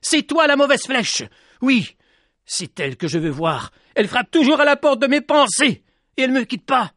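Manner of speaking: put-on a boy exploding at her; furious shout. A narrator's reading. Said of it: "Il explosa de rage et se mit à lui crier".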